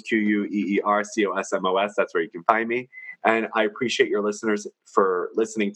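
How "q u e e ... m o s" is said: letter by letter; spelling it out. Shown as a demonstration. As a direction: spell each letter clearly. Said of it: "So yes, Queer Cosmos".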